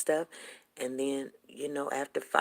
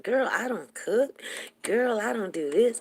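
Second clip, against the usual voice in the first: bragging; 7 semitones higher, 4 dB louder than usual. Retold or imitated. Girlfriend boasting to her about a husband, bragging. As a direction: brag in a high, stretched-out sing-song. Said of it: "She would brag".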